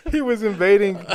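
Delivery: high, loud and laughing; laughing joke. Real, not acted. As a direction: say it through a laugh. Joking, repeated line.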